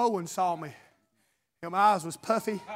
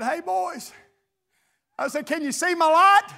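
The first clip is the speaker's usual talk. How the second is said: retold call across the woods, pitched up; 7 semitones higher, 5 dB louder than usual. Preacher re-enacting his call to the lost boys, lightly calling out. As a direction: call to them at a distance, only half raised.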